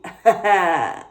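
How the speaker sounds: loud burst of laughter; real laugh, falling away; self-mocking laugh. Real, not acted. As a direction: laugh loudly at your own blunder. Laughs at forgetting the name.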